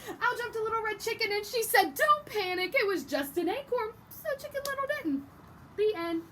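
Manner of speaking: high loud little chicken butting in. Acted. Teacher voicing the chicken interrupting the story, high and loud. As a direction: burst in eagerly like a know-it-all chick.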